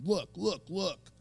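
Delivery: high, urgent repeated look; pleading him to look, voice rising. Retold or imitated. Urging dying man to look.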